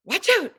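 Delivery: high, fast, staccato warning; mother warning her baby of danger, staccato; sharp warning. Shown as a demonstration. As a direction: snap it out to stop a child in danger. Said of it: "It's fast and staccato".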